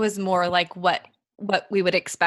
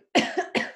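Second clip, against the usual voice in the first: sudden dry cough; coughing fit. Real, not acted. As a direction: cough hard once. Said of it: "Sorry, I had to cough".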